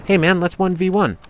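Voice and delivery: high, easygoing friendly challenge; friendly invite. Shown as a demonstration. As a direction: pitch it up like a friendly ask. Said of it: "that's not how you try and one v one".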